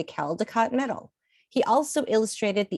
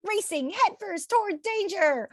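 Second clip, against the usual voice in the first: plain reading voice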